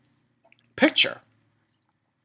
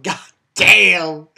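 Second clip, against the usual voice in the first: exclaimed reaction; 2 semitones higher, 8 dB louder than usual. Retold or imitated. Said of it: "and I'm like".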